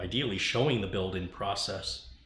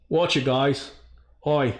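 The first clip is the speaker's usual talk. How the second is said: a single loud, higher "Hi"; mock Brian greeting; impression of Brian; 4 semitones higher, 9 dB louder, slower than usual. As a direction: chirp one bright hello as Brian. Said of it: "Here's my impression of Brian".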